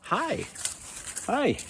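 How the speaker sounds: very high sing-song hi to the dog; high-pitched pet greeting; puppy greeting. Real, not acted. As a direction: squeak a hello at the puppy.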